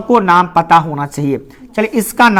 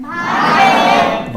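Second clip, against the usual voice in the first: confident class shouting the answer; bold shout; 10 semitones higher, much slower than usual. Shown as a demonstration. Teacher mimics students who know it, shouting boldly. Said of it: "आवाज बड़ा bold निकलता रहेगा".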